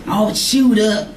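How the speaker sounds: slow, teasing, gossipy mimic; lightly voiced gossip pointing at the Bible; mock Sister Griffin. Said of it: "she gonna highlight it with arrows".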